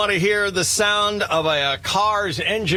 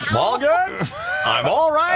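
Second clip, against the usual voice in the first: laughing; 8 semitones higher than usual. Real, not acted. Recovering from laughing fit.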